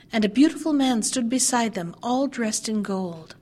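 ordinary delivery

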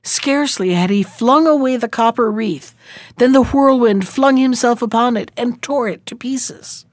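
ordinary delivery

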